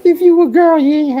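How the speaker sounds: mock high call-out. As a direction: squeak it at the women.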